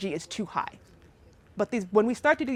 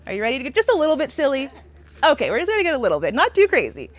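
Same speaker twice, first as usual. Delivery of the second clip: playful hype; 3 semitones higher, 6 dB louder, faster than usual. Real, not acted. Coaxing class into silly exercise.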